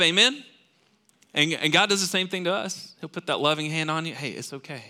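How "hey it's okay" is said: soft reassurance. Retold or imitated. God's loving hand voiced.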